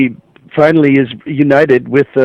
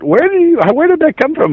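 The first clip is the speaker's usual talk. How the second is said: surprised; 14 semitones higher than usual. Retold or imitated. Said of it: "and I said".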